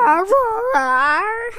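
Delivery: loud roaring "arr" as mock Northern Irish; mock Northern Irish accent. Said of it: "This is my Northern Ireland accent".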